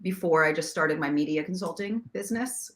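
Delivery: ordinary delivery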